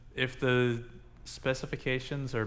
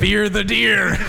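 much higher, shouted battle cry; war cry; 10 semitones higher, 11 dB louder, slower than usual. Acted. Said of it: "that's my war cry".

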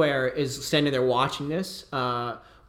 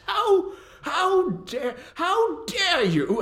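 a much higher, very slow, spluttering outrage; outraged mage; much slower than usual. Acted. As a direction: choke on the insult. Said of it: "He says".

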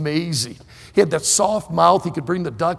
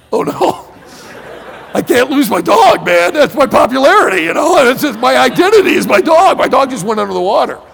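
loud, high comic panic; panicked yelling; 7 semitones higher, 10 dB louder than usual. Retold or imitated. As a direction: wail as if your dog just went under. Retold panic over sinking dog.

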